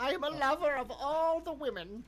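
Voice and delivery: very high, loud, hammed-up hero voice; character voice. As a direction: belt it out as a swaggering swordsman. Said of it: "that's not my guy's voice".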